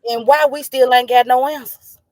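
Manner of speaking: grieving friend demanding answers, real anger; angry demand. Real, not acted. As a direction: push the question out in anger. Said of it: "I'm truly angry because why".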